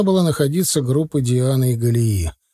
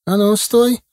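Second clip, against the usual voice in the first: loud, higher barked command; sharp stop order; 9 semitones higher, 4 dB louder, slower than usual. A narrator's reading. Doorkeeper stops a boy at the turnstile, loud and sharp. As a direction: halt him on the spot.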